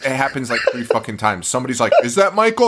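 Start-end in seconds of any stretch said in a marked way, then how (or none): 2.0-2.7 high-pitched imitation of a worried onlooker; hammed-up, high questioning; mock alarmed question. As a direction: ask it in pretend alarm. Acting a townsperson's alarmed question.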